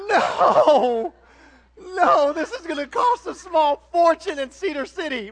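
half-laughing hammed-up cry of despair; mock wail. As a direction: wail it like a comic lament. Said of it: "that was a little more enthusiastic there".

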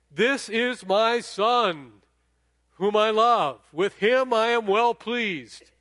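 loud, slow and high proclamation; booming God voice. Retold or imitated. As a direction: proclaim it from heaven. Voice from heaven retold loudly.

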